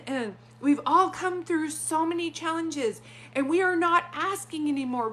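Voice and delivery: claiming it. Retold or imitated. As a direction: proclaim it to the group like an affirmation. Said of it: "like, claiming it".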